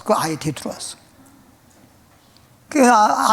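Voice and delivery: ordinary delivery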